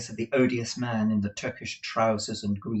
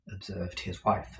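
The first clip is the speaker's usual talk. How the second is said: plain reading voice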